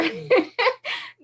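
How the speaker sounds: high burst of laughter; laughing. Real, not acted. Speaker laughing at her own doubting impression. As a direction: break into laughter.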